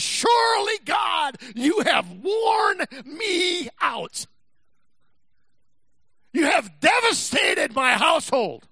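high, loud, slow lament to God; Job's anguished cry. Acted. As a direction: cry out like worn-out Job. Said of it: "He says".

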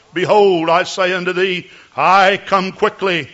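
solemn prophecy in the voice of God; mock biblical prophet. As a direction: speak as the Lord, lofty and grave. Prophecy in God's voice.

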